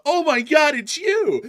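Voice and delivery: high squealing gush of a fan; starstruck fan squeal. Retold or imitated. Fan spotting the host in public, high excited squeal. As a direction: shriek it like a fan spotting a star. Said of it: "They're like".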